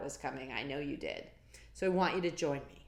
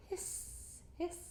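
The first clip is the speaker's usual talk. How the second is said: whispered hiss; hissing tea kettle; 14 semitones higher, 7 dB quieter than usual. Acted. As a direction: let the kettle hiss softly. Kettle sound effect.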